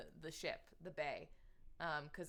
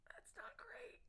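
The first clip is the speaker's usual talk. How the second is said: whisper; 13 dB quieter than usual. Real, not acted. Shot three times, reacts.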